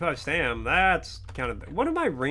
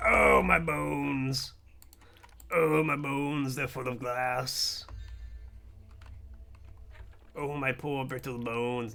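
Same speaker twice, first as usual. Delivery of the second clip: mock moaning over bones; 4 dB quieter, slower than usual. Game fall damage, mock moaning.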